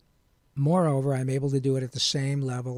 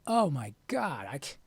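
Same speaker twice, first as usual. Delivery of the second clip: mock dismay; 3 semitones higher than usual. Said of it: "I would have said".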